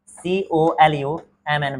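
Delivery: slow letter-by-letter spelling, each letter apart; spelling it out slowly. Shown as a demonstration. Teacher spells the English word for the class, slow and deliberate. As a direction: spell it out for the students, one letter at a time. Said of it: "ध्यान से देखिएगा spelling इसकी".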